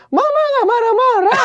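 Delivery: very high-pitched mock-mom denial; hammed-up indignant Minang mom; imitating angry mother. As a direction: shriek like a mother insisting she is calm. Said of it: "Gitu nyokap gue".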